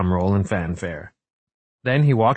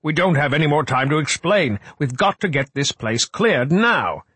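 thundering urgency; 7 semitones higher than usual. A narrator's reading. Frock demands the place be cleared, thundering urgency. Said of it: "Frock thundered".

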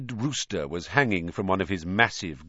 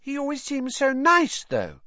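put-on uncertain girl. A narrator's reading. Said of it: "said Hannah uncertainly".

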